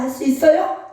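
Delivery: usual speaking voice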